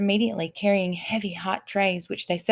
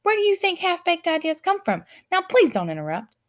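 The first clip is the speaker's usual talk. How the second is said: irritated king; 11 semitones higher, 4 dB louder than usual. A narrator's reading.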